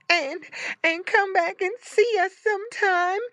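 very high, tearful little pig voice; sniffling. A narrator's reading. Curly Tail to the brother she loves, sniffling. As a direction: stammer a tearful goodbye. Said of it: "sniffled little Curly Tail".

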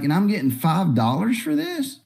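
retold outrage at the pay; mock disbelief. [name] to Marvel and DC about sketch pay, incredulous. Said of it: "And I'm like".

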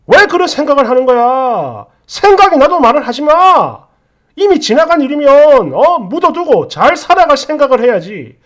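angry, nagging protest in a couple's row; exasperated husband. Acted. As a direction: have it out with your partner, fed up. Acted husband in couple's quarrel.

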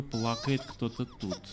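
ordinary talk, nothing marked

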